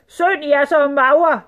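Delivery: loud, high, drawn-out grumble; grumbling sultan. A narrator's reading. Sultan eyeing the skinny girls, loud grumbling. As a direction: complain slowly in a big sultan voice. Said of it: "brummede sultanen".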